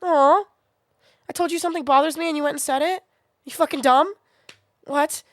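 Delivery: high, loud exasperated disbelief; exasperated outburst. Real, not acted. Venting at a commenter who said it anyway, exasperated. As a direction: fire off the disbelief. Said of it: "don't have a filter right now".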